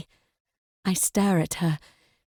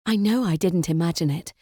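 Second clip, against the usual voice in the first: plain reading voice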